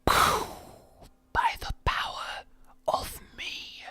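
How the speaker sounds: breathy spell whisper; whispered incantation. Pretend. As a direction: hiss it like a secret spell. Mock incantation after dark lord.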